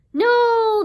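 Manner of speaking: long, high, loud refusal; screaming. Acted. All the sisters refusing to tell, screaming. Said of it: "they all screamed".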